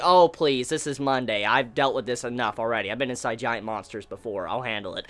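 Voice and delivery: low, soft, unbothered hero imitation; imitating a seen-it-all shrug; casual dismissal. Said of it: "Jack just saying it so casually".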